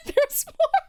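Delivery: high voice breaking into real laughter; too tickled to get through the story; barely holding together. Real, not acted. Teller struggling with own laughter.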